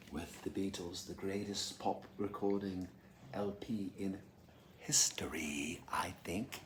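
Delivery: very soft, sinking to a whisper; under the breath, almost whispered; hushed aside. Real, not acted. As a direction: drop right down into a hushed murmur. Hushed aside about the album.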